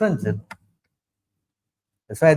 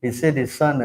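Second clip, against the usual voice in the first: ordinary talk, nothing marked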